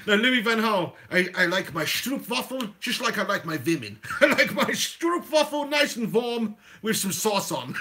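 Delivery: hammed-up Dutch accent; comic Dutchman relishing his own joke; deliberate Dutch-accented English; Louis van Gaal. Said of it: "do an L V G impression".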